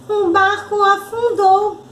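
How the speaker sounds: put-on high-pitched little-kid guess; eager child puppet. Arabella guesses the story's ending, eager child. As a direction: answer like a keen small pupil.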